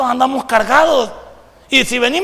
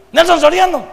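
a mock call of a full name, higher and louder; calling his name; 7 semitones higher, 6 dB louder than usual. Someone calling him by his real name, mock loud call. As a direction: shout his name to get his attention. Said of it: "Por Nelson Soriano no entendía".

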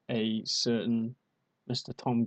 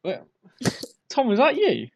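high, loud startled call; startled shouting; 9 semitones higher, 8 dB louder than usual. Acted. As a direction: shout out in alarm at the voice. Parent hears voice from garden.

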